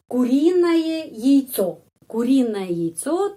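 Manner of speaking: куриное яйцо drawn out slowly; slow demo. Shown as a demonstration. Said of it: "penekanan di sini huruf O". (куриное яйцо diucapkan pelan)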